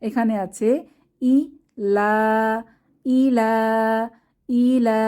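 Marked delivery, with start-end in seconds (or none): 1.2-5.0 ই লা sounded out slowly, then blended; syllable by syllable. Shown as a demonstration. Quran teacher spelling a word for students, slow. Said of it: "teacher spelling Quran word".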